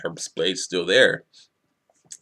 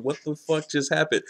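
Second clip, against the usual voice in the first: higher-pitched baffled question; retold disbelief; 7 semitones higher than usual. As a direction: replay your bewilderment, pitched up. Retelling own shocked first reading.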